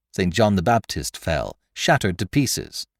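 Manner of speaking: ordinary delivery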